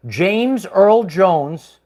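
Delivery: each name dragged out very slowly; very slow emphasis. Shown as a demonstration. Revealing to viewers, dragged out very slowly. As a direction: spell the name out in slow motion.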